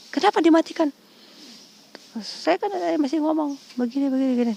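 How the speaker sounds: high-pitched mock scolding, loud on the first demand; imitating irate caller. Debt caller demands why she hung up, mock indignant.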